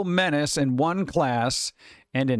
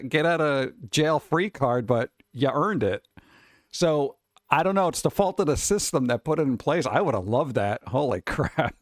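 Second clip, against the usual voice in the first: ordinary talk, nothing marked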